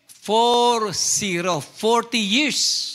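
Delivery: very slow, each word spaced out; slow emphatic count. Real, not acted. Preacher stressing David's forty-year reign, very slow. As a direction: drag it out to let the number land.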